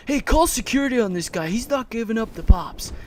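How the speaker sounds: loud, high, fast imitation; mock calling out. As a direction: call for security like an outraged customer. Mimics rival calling for security.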